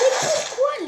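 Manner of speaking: high, loud outburst; exasperated shout. Real, not acted. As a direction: yell it at the game. Gamer fails again.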